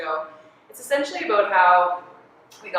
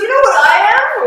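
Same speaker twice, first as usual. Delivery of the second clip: laughing quote; 14 dB louder than usual. Retold or imitated. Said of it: "he goes".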